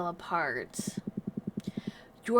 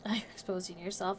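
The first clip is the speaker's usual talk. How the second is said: fast breathy whisper; 3 semitones lower, 8 dB quieter, faster than usual. Acted. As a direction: hush it quickly. Whispered character line.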